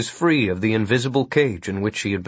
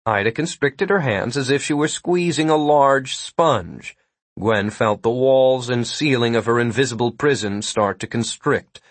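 ordinary delivery

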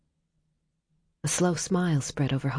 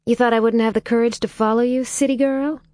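teasing; 7 semitones higher, 6 dB louder than usual. A narrator's reading. Holly teasing Leo on roof.

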